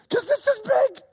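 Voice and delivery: high, urgent emphasis; excited angel. Pretend. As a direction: lean in and sell how huge it is. Angel stressing huge news.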